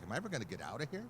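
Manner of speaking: very fast weary inner question; new hire silently despairing, fast; exasperated thought. Retold or imitated. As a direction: rattle off the wish to escape.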